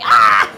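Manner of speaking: sudden loud "Ah!" yell; playful yell. Pretend. Streamer joking about being the one who yells, mock yell. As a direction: let out a short mock scream. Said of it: "people call me the person who yells".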